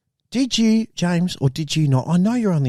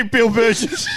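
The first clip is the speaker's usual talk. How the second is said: joyful shout; 11 semitones higher, slower than usual. Pretend. Son hails his newfound dad with a joyful shout. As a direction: shout the name in mock joy, then laugh.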